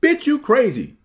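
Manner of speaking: an outsider awkwardly joining the women's banter, shrill; mock sassy shout. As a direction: overdo the sassy line so it lands wrong. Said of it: "I can't be like".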